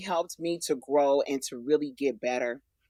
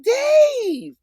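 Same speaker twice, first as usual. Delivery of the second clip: surprised; 10 dB louder than usual. Retold or imitated. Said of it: "I was just like".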